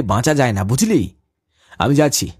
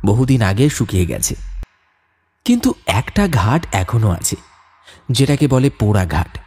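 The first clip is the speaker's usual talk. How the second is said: low, grave storyteller voice; story narration; 3 semitones lower than usual. A narrator's reading. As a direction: narrate the ghost legend ominously. Narrator voice between scenes. (গম্ভীর গল্পকথকের বর্ণনা)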